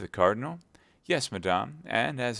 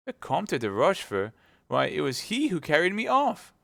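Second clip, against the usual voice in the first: high, louder shocked outburst; 9 semitones higher, 5 dB louder than usual. Acted. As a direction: recoil in disbelief. Wife learns abductor paid husband.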